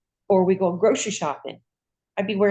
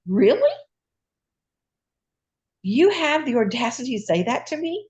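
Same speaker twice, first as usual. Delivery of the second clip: higher, incredulous retold comeback; indignant disbelief; 5 semitones higher than usual. Patient retelling her comeback to the doctor, indignant. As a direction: throw it back as an affronted question.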